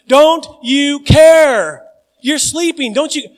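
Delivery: high, loud and drawn out; loud slow desperate plea. Shown as a demonstration. Congregation crying out to sleeping Jesus, loud and slow. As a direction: stretch out care like a panicked cry.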